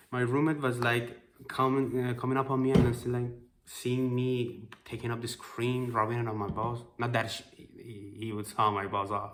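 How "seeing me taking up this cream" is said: usual speaking voice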